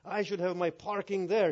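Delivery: hammed-up pompous complaint; acted self-importance. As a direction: imitate an entitled cleric insisting on privilege. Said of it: "He just had a frown on his face".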